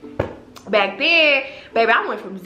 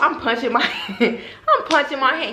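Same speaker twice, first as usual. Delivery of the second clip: ordinary talk, nothing marked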